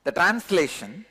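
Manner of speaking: usual speaking voice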